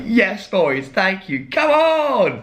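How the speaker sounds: high, rising cheer to a near squeal; mock jubilant. Himself thanking the instructors after the hug, high jubilant cheer. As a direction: celebrate like you just won the cup. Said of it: "and I was like".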